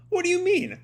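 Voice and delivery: sudden high, startled question; surprised outcry. Acted. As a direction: jump up in pitch, alarmed. Shinobu reacts to Momo.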